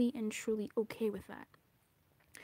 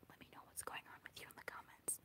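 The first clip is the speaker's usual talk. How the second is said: soft, hushed whisper to viewers; whispered sign-off; 19 dB quieter than usual. Real, not acted. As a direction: breathe it out gently to the camera.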